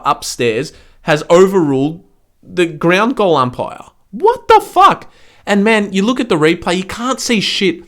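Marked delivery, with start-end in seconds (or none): 4.1-5.1 high, loud, slow exasperated cry; outraged shriek. Real, not acted. Fan shouting at the overruled goal, outraged. 5.4-7.8 higher, indignant complaint; exasperated rant. Real, not acted. Fan griping about the replay cameras, indignant.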